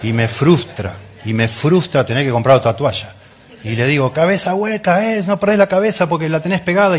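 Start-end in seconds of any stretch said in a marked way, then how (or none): 4.1-7.0 reto fingido, con la voz bien aguda; padre enojado retando. Acted. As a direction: actuar a un padre que rezonga al hijo, en tono agudo. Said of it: "me enojo y le grito a mi hijo". (enojo paterno actuado)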